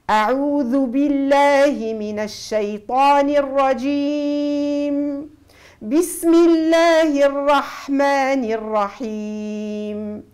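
تلاوة خاشعة مجودة; slow recitation. Shown as a demonstration. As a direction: recite to God, each letter its due. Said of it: "teacher recites full model passage".

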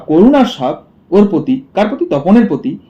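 usual speaking voice

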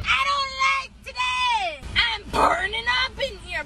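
whiny shout. Acted. Kid complaining about heat.

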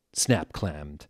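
plain reading voice

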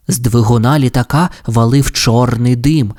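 ordinary delivery, nothing marked

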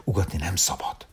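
the man hushes the puppy in a whisper; hushing whisper. A narrator's reading. The man whispers to the puppy not to bark. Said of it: "szájára tette a kezét".

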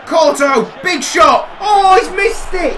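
high, loud play-by-play yells; high excited yells ending in dismay; excited shouting. Real, not acted. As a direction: call the shot, then groan the miss. Live reaction to a missed shot.

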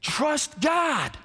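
forceful call. Real, not acted. Preacher calls out the command.

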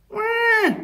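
very high, drawn-out wail; squeaky animal wah; baby seal cry. A narrator's reading. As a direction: bleat like a lost baby seal. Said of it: "answered the baby seal".

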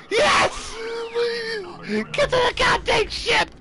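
high, urgent yelling; frantic shout. Real, not acted. Gamer racing to the ship, high frantic shouting. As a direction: yell it desperately, voice way up.

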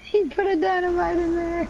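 words squeezed through laughter; high giggling speech; laughing. Real, not acted. Laughing while talking.

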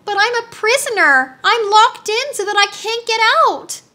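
high, urgent plea; pleading. A narrator's reading. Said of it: "she pleaded".

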